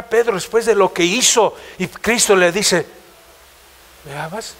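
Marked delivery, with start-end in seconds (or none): 4.0-4.5 ¿Me amas? almost breathed, much softer; hushed question. Acted. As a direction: ask it barely audible, wounded. Cristo pregunta a Pedro. (pregunta casi en un susurro)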